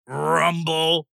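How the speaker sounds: ordinary delivery, nothing marked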